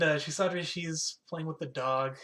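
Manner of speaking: ordinary talk, nothing marked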